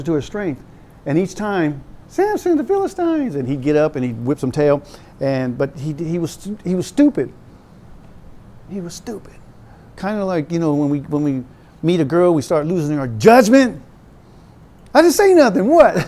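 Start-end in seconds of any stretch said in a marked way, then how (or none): 2.1-3.3 high calling out to Samson; mock alarm call. 14.9-16.0 high, loud feigned innocence; mock innocence. Smitten guy plays innocent.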